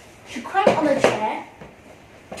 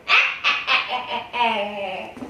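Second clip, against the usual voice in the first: evil cackle; 5 semitones lower than usual. Acted. Witch laughs after spell.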